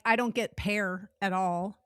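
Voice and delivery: normal talking voice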